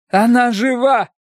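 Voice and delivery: slow, high exclamation; agitated cry. A narrator's reading. Fortune-teller revealing the truth, high agitated cry. Medium's startling revelation.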